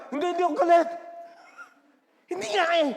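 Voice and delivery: high, loud, exasperated shout; angry mock denial. Person asked if angry insists not, comically furious. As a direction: deny it furiously, hammed up.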